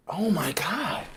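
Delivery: breathless stunned whisper. Real, not acted. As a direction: whisper it in disbelief. Overwhelmed after the win.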